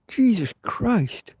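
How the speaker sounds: high, slow drawn-out exasperation; exasperated groan. Real, not acted. After missing the fly again, slow exasperated high groan. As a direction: stretch it out, fed up.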